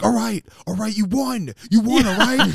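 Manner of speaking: loud, high exasperated caving-in yell; retold yelling. Car salesman yelling at the buddy as he gives in: loud, high yell. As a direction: yell it like a beaten salesman giving up. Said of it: "the salesman's yelling at my buddy".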